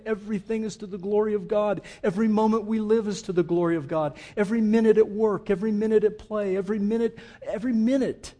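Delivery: fast, high, loud preaching build; rising preaching. Acted. Pastor preaching God's glory to the congregation, fast and rising.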